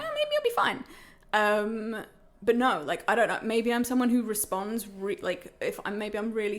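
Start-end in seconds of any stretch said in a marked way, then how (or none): 0.0-0.8 much higher, airy hopeful tone; mock naive hope. Patient recalling her hope about the tiny dose, high mock optimism. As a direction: play your past self as naively optimistic.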